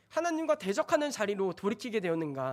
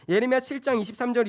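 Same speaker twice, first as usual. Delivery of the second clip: ordinary talk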